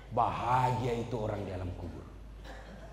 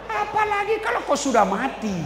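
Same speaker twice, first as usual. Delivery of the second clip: loud punchline; 7 dB louder than usual. Acted. Preacher delivering the joke's punchline to the congregation, loud and high. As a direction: punch it out higher and louder.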